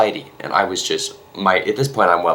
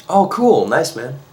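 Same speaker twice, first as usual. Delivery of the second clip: higher, offhand hero reply; casual Spider-Man voice; 7 semitones higher, slower than usual. Retold or imitated. Spider-Man shrugs off a fan's gushing, higher and casual. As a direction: toss it off like a cool superhero.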